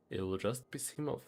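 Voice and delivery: normal talking voice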